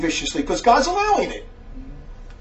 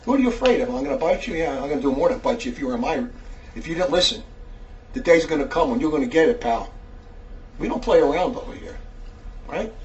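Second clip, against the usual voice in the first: much softer, lower-pitched menace; low warning; 8 semitones lower, 8 dB quieter than usual. Real, not acted. Preacher warning an anonymous letter writer, low and quiet. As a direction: drop low and quiet to warn them.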